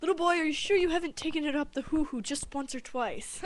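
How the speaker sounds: high-pitched, hammed-up doctor impression; put-on voice of a prying doctor. Doctor pressing the little boy, high comic put-on voice. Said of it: "And it's like".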